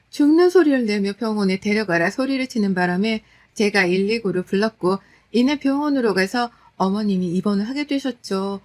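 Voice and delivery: plain reading voice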